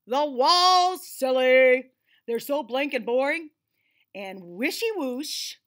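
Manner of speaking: loud, bouncy delight; eager Ted voice. Acted. Ted tells the boy to paint the walls, loud and gleeful. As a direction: tell him the answer's obvious.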